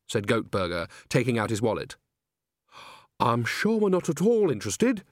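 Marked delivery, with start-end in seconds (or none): 3.2-5.0 higher-voiced, mildly anxious brush-off; hurried deflection. A narrator's reading. Nanny tries to get Granny out, mildly flustered. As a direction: try to steer your friend away. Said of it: "hurriedly pulling on Granny's arm".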